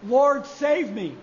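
slow, drawn-out plea; slow cry of faith. Acted. Peter calling on Jesus, slow and earnest. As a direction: stretch each word out slowly. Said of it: "cry out to Jesus".

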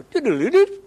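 high sung doo-doo of a phone; phone chime imitation. Preacher imitating his phone's chime, high and sung. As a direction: mimic the phone's startup jingle. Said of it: "you go".

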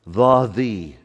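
"The, the" each word set apart and stressed. Shown as a demonstration. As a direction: repeat "the" slowly, leaning on it each time. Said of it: "that's a definite article".

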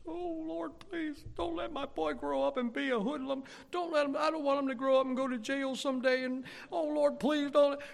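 high, wailing imitation of a crying mother; mom's tearful prayer. Mom praying for her boy, mock tearful wail. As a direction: plead with heaven in a hammed-up sob. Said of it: "And she's crying".

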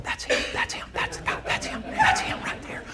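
hushed whisper, no voice; whispering teammates. Retold or imitated. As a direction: whisper it like gossip in the locker room. Teammates whispering about the dad.

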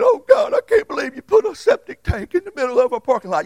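high, loud mock shout of frustration; retold exasperated outburst. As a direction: act out losing your temper at God. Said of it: "I didn't get mad saying".

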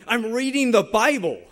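loud, high snap at his wife; irritated yell. Retold or imitated. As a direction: re-enact yelling at her in irritation. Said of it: "I yell at her".

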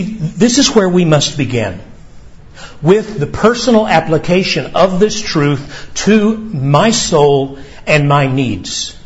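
ordinary delivery

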